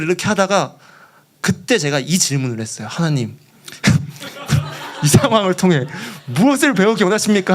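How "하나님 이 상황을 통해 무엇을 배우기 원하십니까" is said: half-laughing, worked-up plea; mock exasperated prayer. Fuming missionary questioning God, exasperated mock prayer. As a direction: demand an answer with comic frustration. Angry man asks God, comic.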